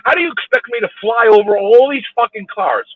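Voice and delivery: loud, high-pitched exasperation; angry rant. Real, not acted. Driver railing at a honker stuck behind him, angry rant. Driver ranting at honking motorist.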